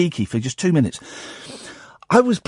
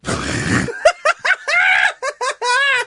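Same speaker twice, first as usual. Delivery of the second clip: big laugh. Real, not acted. Host cracks up.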